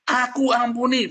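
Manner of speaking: slow, high, loud shout; drawn-out demonstration yell. Shown as a demonstration. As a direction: shout it out slowly for them to copy. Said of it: "Teriak, saudara".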